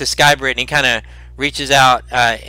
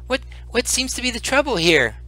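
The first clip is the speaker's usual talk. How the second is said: put-on high, soft old man's voice; little old man; 11 semitones higher, 5 dB quieter than usual. Frail healer asking the injured party about the trouble, little old man voice. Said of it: "uh, little old man".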